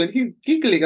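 ordinary talk

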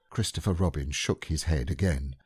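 usual speaking voice

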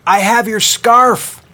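loud, high, slow shout up to the ferry; calling out. Acted. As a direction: yell up proudly from the lake. Calling up from the water.

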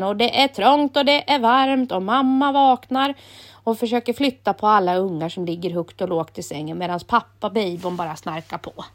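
ordinary delivery

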